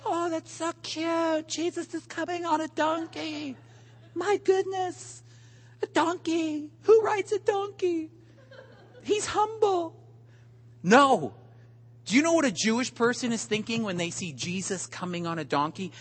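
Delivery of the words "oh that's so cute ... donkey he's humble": high, soft, sugary cooing; mock cutesy gushing. Preacher mocking how modern believers coo, high and cutesy. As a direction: gush like it's adorable.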